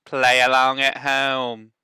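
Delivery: big, slow, drawling Southern podcast welcome; Southern accent. Pretend. Band teacher welcoming students in a hammed-up Southern accent. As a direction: roll out the show name in a hammed-up Southern drawl. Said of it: "doing his requested Southern accent".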